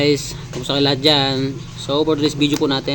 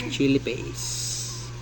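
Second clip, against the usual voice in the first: hushed aside; 8 dB quieter, much slower than usual. Acted. Secret chili, whispered aside.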